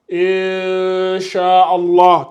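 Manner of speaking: "Inch'Allah" with the n hidden in a long, drawn-out hum; slow recitation of "Inch'Allah", n melted away; ikhfa dissimulation. Shown as a demonstration. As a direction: hold the hidden n of "Inch'Allah", very slowly. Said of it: "c'est la dissimulation".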